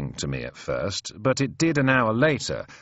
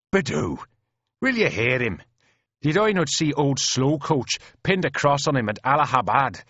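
animated brogue, a little higher; lightly voiced Irish soldier; 5 semitones higher than usual. Irish private rebuking his doubting mate, animated. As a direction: vouch for him with mild indignation. Paddy indignantly vouching for the officer.

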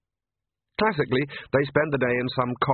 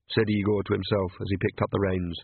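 ordinary delivery, nothing marked

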